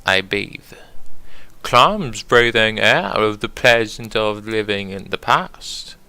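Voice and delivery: fancy British poetry recital; British accent. Acted. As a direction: read the poem in a put-on British accent. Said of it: "I gotta do it on British accent".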